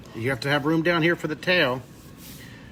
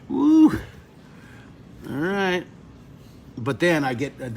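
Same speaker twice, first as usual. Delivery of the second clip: ordinary delivery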